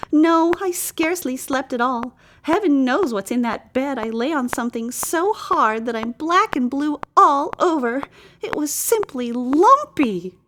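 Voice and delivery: high, aggrieved princess complaint; fussy damsel. A narrator's reading. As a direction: whine it like a pampered princess.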